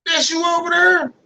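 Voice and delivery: high, incredulous question; surprised quote. Retold or imitated. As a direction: act amazed to spot them. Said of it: "I was like".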